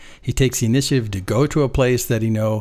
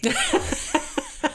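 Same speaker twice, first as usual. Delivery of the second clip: a high burst of amused laughter; 5 dB quieter than usual. Real, not acted. Laughing at the idea of escaping, high burst of laughter. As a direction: laugh at the thought of running away.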